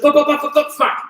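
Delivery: high-pitched "bup-bup-bup" strike noises; imitated rapid strikes. Coach showing how fast fighters throw stuff, imitated rapid strikes. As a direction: mimic a flurry of hits landing, pitched well up.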